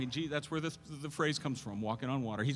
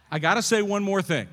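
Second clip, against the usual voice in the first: loud, raised, insistent preacher's declaration; emphatic repeat; 7 semitones higher, 10 dB louder than usual. Real, not acted. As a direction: belt it out to hold the room. Emphatic repeat of his announcement.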